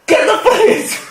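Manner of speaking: high frantic call for help; high, hammed-up cry for the police; mock panic.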